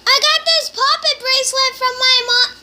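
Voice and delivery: calling to mom in another room; loud repeat. Real, not acted. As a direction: shout it so mom hears. Repeating line loudly to mom.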